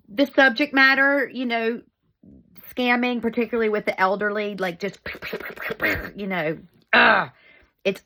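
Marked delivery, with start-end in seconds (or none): none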